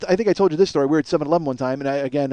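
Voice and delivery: normal talking voice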